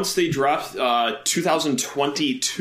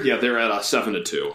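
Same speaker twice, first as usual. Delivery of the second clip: ordinary talk, nothing marked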